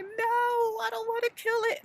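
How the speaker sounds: very high, fast frantic no; refusing daughter, very high and fast; frantic refusal. Retold or imitated. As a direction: protest in a panic. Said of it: "I was like".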